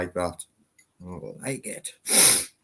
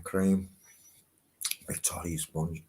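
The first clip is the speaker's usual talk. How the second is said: normal talking voice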